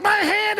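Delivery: high-pitched yelp of pain, acted; pained cry. Hiker with a thorn in his hand, high pained cry. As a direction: cry out high, as if just stabbed.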